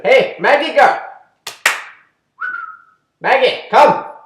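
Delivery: high, loud call to a dog. Real, not acted. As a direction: shout across the yard for Maggie to come. Calling the dog outside.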